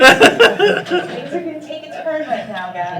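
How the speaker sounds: laughing, slow. Real, not acted. Laughs while announcing.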